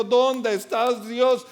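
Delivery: mock complaint to God, half-hearted; queja a Dios. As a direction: whine at God as if betrayed. (queja fingida a Dios)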